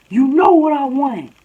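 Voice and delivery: high, loud mocking imitation; imitated confrontational shout. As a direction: throw it back loudly, mimicking the challenger. Screaming girl answers back, imitated.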